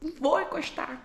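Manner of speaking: high, drawn-out reassurance; exasperated pleading. Retold or imitated. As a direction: plead with a panicking child, stretching the words. Exasperated pleading with panicking child.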